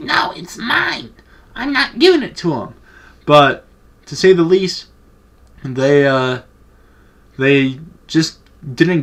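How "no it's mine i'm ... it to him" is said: mock a kid throwing a fit, voice way up high; tantrum. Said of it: "just throwing a fucking fit".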